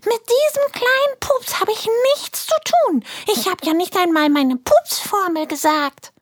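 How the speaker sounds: tiny cheeky creature defending itself; squeaky fart creature. Acted. Pupsi Jones keeps his voice. (sehr hohe, freche Piepsstimme)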